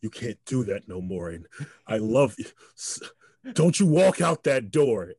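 pleading, then loud and slow at the door; acted father pleading with feeling; emotional plea. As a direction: beg him not to walk out. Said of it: "with all this emotion".

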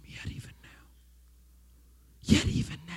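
Yet even now breathed in a soft whisper; a breathy hush on Yet even now. Shown as a demonstration. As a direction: whisper it gently to him. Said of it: "I want you to whisper to him".

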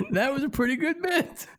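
high squeaky laugh through the words; real laughter breaking the line. Real, not acted. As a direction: laugh it out, barely composed. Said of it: "laughing at the call".

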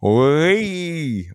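high, drawn-out cartoon whee; playful squeal. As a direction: let out a gleeful little mock cheer. Acting out dancing eyebrow caterpillars.

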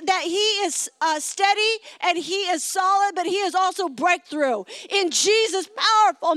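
intense preacher's prayer, rising; fervent prayer. Acted. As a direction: pray over him with fire. Pastor prays aloud over man.

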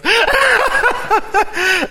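high, loud laugh; hearty laugh. Real, not acted. Preacher laughing at his pastor's catchphrase. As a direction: laugh with fond delight.

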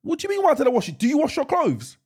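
high-pitched, incredulous comeback; indignant retort. Retold or imitated. Customer challenged over washing a jumper, indignant and incredulous. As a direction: throw the question back, voice shooting up in disbelief. Acting out indignant reply to clerk.

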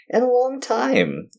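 high, slow, stretched whine; mock-dramatic squeak on a long time. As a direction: drag it out in a squeaky pout.

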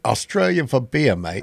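mock Australian slogan voice; Australian accent. Co-host answers with the beer slogan, Australian accent. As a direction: put on an Aussie accent for the catchphrase.

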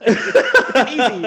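high squeal of laughter through the words; words squeezed out through a laugh. Real, not acted. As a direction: break up laughing on it. Laughing mid-sentence in podcast chat.

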